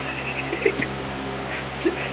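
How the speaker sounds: long, soft breathy laughter; quiet laugh. Real, not acted. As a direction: let a hushed laugh roll on. Laughs at her own teasing. (long rire étouffé)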